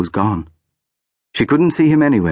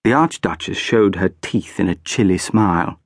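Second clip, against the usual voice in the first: ordinary delivery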